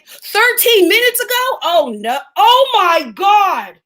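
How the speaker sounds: high, loud cry of disbelief; horrified shock. Real, not acted. Finding the news from minutes ago, horrified. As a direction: voice leaps up, then drawn-out horror. Confirms the death just happened.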